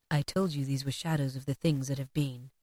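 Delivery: low, soft ghostly voice; a hushed otherworldly spirit; unearthly voice. A narrator's reading. Ghost answers Scrooge.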